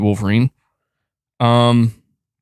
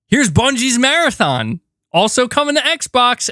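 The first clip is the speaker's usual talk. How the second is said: mock presenter reveal; 11 semitones higher than usual. Sony presenting Marathon to viewers, hammed-up announcer. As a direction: sell the last reveal like a showman.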